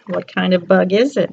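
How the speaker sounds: usual speaking voice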